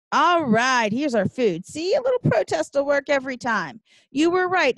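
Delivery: loud, high-pitched crowing; triumphant excitement. Acted. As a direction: celebrate a protest that worked. Food arrives after the protest.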